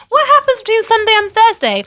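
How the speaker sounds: high, loud incredulous question; exasperated outburst. Retold or imitated. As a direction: retell your baffled outcry at full volume. Retells own baffled reaction to announcement.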